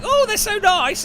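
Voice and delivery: very high, loud mock delight; gushing flatmate. Flatmate stuffing her face with sweets, mock gushing. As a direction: imitate a flatmate raving about sweets. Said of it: "And she's like".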